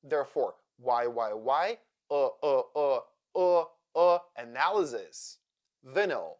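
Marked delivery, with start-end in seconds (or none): 0.8-5.4 Y repeated, then UH repeated, into analysis; schwa drill. Shown as a demonstration. Teacher drilling the schwa in analysis, schwa drill. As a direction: drill Y turning into a neutral UH before analysis. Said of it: "Y sounds like uh".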